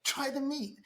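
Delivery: a hammed-up insistent Dutch host; insistent pushing. Husband insisting, rising.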